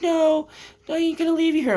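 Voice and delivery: imitating girlfriend. Mimicking his girlfriend refusing to leave him, high mock voice. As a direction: squeak out her protest way up high.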